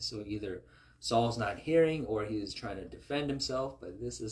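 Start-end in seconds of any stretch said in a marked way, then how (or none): none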